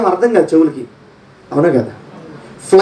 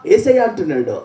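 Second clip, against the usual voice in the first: ordinary delivery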